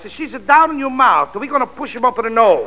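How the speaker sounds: hammed-up Italian accent; Italian-accented janitor-professor teasing his pupil. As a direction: play a jolly Italian music professor.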